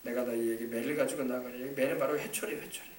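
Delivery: soft, low pastoral exhortation; tender. Real, not acted. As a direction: gently coax the congregation.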